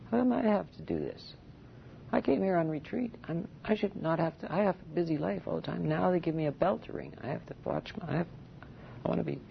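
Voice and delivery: mock self-pitying grumble; whinging monk. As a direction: play the put-upon retreatant moaning about the bell. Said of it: "That, of course, is whinging".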